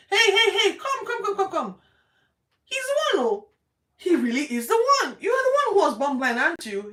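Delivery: excited shouting crowd. Retold or imitated. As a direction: yell it across the road in amazement. Excited townspeople shout at healed man.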